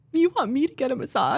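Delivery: high, incredulous question; disbelieving echo of the suggestion; a light mock surprise; surprised disbelief. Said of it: "I was like".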